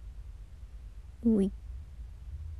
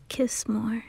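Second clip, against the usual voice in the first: whisper; 7 dB quieter than usual. Acted. Tender promises in closet.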